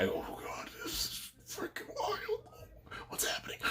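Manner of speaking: retold hushed aside, holding it in; stifled whisper. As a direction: whisper it as if trying not to laugh. Retold trying not to laugh aloud.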